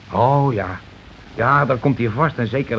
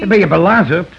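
loud scornful retort; 7 semitones higher, 7 dB louder than usual. Acted. Heated argument about police.

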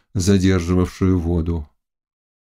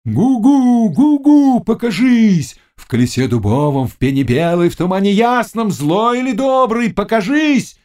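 high, loud chanted summoning; witch's shouted incantation; 13 semitones higher, 5 dB louder than usual. A narrator's reading. Witch summoning spirits at the mill wheel, shouting. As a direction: cry out a spell, dipping then climbing high. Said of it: "начала кричать".

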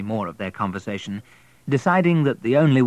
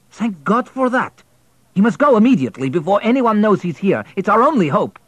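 raised, higher, urgent man's voice; louder, pressing the wife to get rid of him; a husband insisting in a raised voice; Pyotr, urgent husband; 11 semitones higher, 8 dB louder than usual. Acted. Husband urging, voiced loudly.

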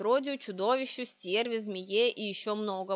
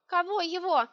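higher-pitched baffled question; puzzled; 8 semitones higher, 4 dB louder than usual. A narrator's reading. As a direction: ask who on earth is meant. Heroine puzzled by witch's demand.

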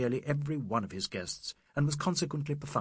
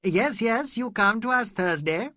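louder and higher, calling across; calling from afar; 9 semitones higher, 7 dB louder than usual. A narrator's reading. Mr Bhattacharya calling to the ladies from afar, loud. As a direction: call out across the lawn. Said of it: "her husband called from the distance".

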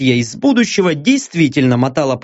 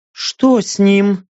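slightly raised, drawn-out anxious question; worried woman; 4 semitones higher, much slower than usual. A narrator's reading. Мия over the collapsed Стриж, worried. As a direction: ask it as a helpless onlooker. Мия беспомощно наблюдает за больным.

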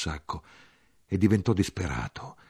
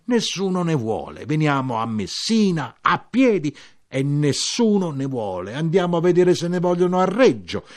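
rising, louder despairing plea, much higher; desperate lament; 9 semitones higher, 8 dB louder than usual. Acted. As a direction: cry out that nobody wants the oranges. Lamento disperato del siciliano. (lamento disperato, sempre più alto e forte)

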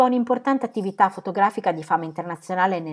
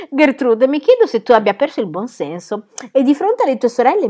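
loud, higher, scolding voice; indignant scolding; 7 semitones higher, 8 dB louder than usual. Acted. Aunt scolds Gertrude before her sisters, loud indignant rebuke. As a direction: scold her like a wayward child.

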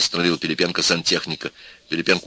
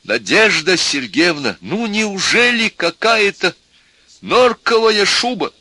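high, slow, drawn-out plea; imploring appeal; 12 semitones higher, 5 dB louder, slower than usual. A narrator's reading. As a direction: appeal to her in anguish. Professor dictates an imploring appeal.

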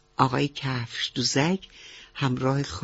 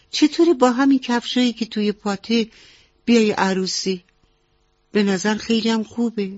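higher, louder storybook frog with an idea; high-pitched, encouraging frog auntie; 7 semitones higher, 4 dB louder than usual. Acted. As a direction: pitch up and suggest it eagerly.

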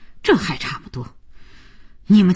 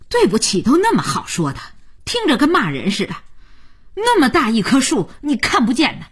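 scolding; 13 semitones higher, faster than usual. A narrator's reading. Grandma scolding the neighbour's son.

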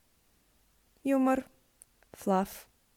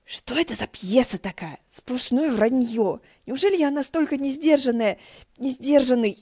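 indignant whisper; 5 semitones higher, 6 dB louder than usual. Acted. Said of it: "возмущённо зашептала ему в ухо".

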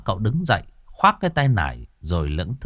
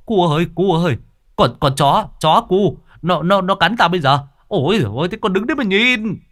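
flustered; 7 semitones higher, 3 dB louder than usual. Acted. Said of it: "cuống cuồng".

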